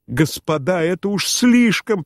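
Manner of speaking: high-pitched cry of despair; desperate cry. A narrator's reading. General protesting to the guests, despairing cry. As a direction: cry out as if it is all too much. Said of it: "прокричал он в отчаянии".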